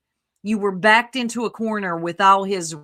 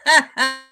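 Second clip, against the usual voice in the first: loud, high laugh; burst of laughter; 5 semitones higher, 7 dB louder than usual. Real, not acted. Amused reaction to chat remark.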